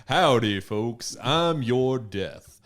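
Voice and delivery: slow, menacing cowboy drawl; menacing Texan villain. Pretend. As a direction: play a Texan grim reaper.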